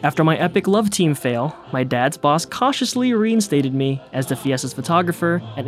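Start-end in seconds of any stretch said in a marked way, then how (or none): none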